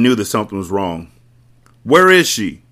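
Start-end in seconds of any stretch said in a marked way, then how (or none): 1.8-2.6 loud, high demand; shouted question. A narrator's reading. Mecca gripping Mati by his dreadlocks, shouting. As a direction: shout it down at him. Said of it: "Mecca shouted".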